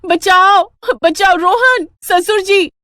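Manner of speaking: loud high cry for help; screaming for help. Acted. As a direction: yell for rescue at the top of your lungs. (ज़ोर से चीखकर मदद माँगना)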